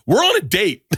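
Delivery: put-on high pitched put-out girlfriend; annoyed girlfriend. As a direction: cut him off in a high miffed voice. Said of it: "his girlfriend goes".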